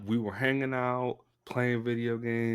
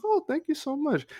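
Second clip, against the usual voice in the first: much higher, delighted thanks; gushing high voice. Retold or imitated. Thanking the boss, much higher and delighted. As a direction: gush like a flattered employee. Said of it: "I'm like".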